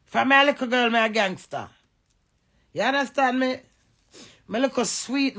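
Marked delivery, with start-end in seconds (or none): none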